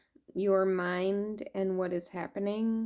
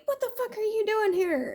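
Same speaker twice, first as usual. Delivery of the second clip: high, fast startled demand; startled alien voice; 13 semitones higher, faster than usual. Retold or imitated. Alien being startled by her, high fast demand. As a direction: shoot it out high as the shocked being.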